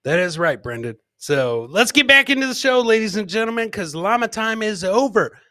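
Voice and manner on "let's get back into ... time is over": usual speaking voice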